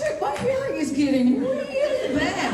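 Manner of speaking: high, laughing self-quote; amused mock disbelief. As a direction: squeal it through laughter. Acting out own reaction, laughing.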